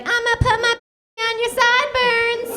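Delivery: put-on high little girl rapping. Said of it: "this little girl comes on, she's like".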